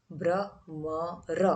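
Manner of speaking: ब्रह्मर drawn out slowly, syllable by syllable; ब्रह्मर spelled out slowly for the class; slow demo. Shown as a demonstration. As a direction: stretch ब्रह्मर to show the अर sound. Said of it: "अर शब्द का उच्चारण यहाँ पे बनता है".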